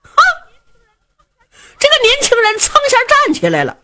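ordinary delivery, nothing marked

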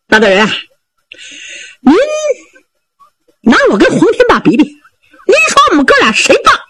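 high, fast, laughing braggart voice; cocky sidekick angling for praise; boastful Zhao Bi. Acted. Zhao Bi bragging to Lord Shi.

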